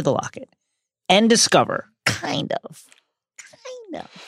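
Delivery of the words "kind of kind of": whispered hedge, fading away; hushed aside. Pretend. Qualifying the praise of Ron, whispered aside. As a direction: drop to a whisper, softer still.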